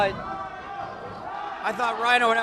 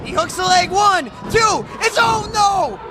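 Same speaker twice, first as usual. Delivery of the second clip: excited count; 5 semitones higher than usual. Real, not acted. Pin count called live.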